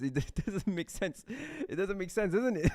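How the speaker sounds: high voice breaking into laughter; laughing in disbelief. Real, not acted. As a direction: insist through a fit of giggles. Laughing at absurd comments.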